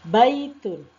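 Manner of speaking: "Baitun" high and loud, falling on tun; pronunciation drill. Shown as a demonstration. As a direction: lead a class repeating "Baitun". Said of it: "Kita ulang bersama-sama".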